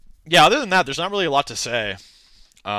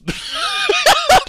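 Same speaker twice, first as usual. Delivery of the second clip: a very high, loud cackle; high squealing laugh; 7 dB louder than usual. Real, not acted. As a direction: burst out in a shrill giggle at the absurdity. Laughing at his haters' DMs.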